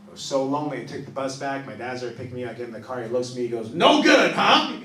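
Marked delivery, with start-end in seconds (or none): 3.7-4.7 loud, higher blunt dad; knowing dad. Retold or imitated. Dad greeting heartbroken son at bus, loud and blunt. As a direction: sum it up bluntly like a knowing father.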